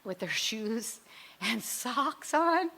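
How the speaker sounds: high, loud, frantic rising panic; panicked overwhelm. Retold or imitated. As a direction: spiral into mock panic about socks and school. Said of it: "I was like".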